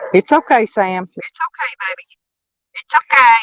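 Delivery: high, gentle pet talk; soothing a scared dog. Real, not acted. Soothing dog Sam outside.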